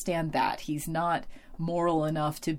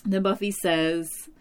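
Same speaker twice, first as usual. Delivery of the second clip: normal talking voice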